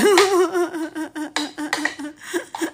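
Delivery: a high rising burst of laughter; high laughing fit. Real, not acted. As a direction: laugh helplessly at the joke. (tawa meledak tinggi)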